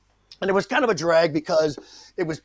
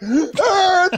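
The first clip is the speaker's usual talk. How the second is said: very high, loud, drawn-out yell; hammed-up scream; 6 dB louder than usual. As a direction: scream the line wildly over the top. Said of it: "shaking the shit out of it".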